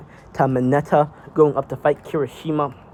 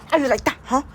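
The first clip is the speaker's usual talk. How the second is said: high, cocky fighter's jeer; cocky taunt; 11 semitones higher than usual. Retold or imitated. Mina taunting Deku in fight.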